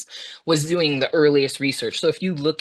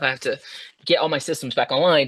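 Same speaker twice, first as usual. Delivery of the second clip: ordinary talk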